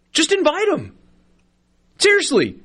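excited urging. Real, not acted. Urging bowls to invite team.

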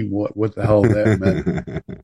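normal talking voice

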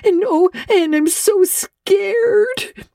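high, breathless and tearful, slowing to a sob at the end; a scared child blurting out bad news; frightened girl. Diana panics, sister sick.

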